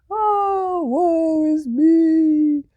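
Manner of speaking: hammed-up tinker lament; woe-is-me wail. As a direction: stretch the woe out high. Tommy moaning again.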